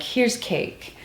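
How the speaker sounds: coaxing hushed offer; soft whisper. Retold or imitated. Assistant coaxing Judy to eat, soft whisper.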